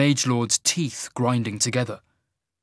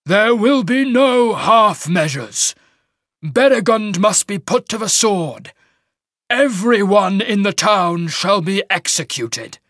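high, loud proclamation; booming, merciless shaman; 9 semitones higher, 7 dB louder than usual. Acted. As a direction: mage lord passing a death sentence.

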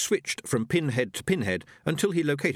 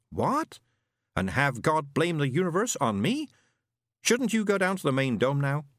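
higher, precise machine voice; pompous robot; 6 semitones higher than usual. A narrator's reading. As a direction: list it like a know-it-all robot. Robot Isaac speaks.

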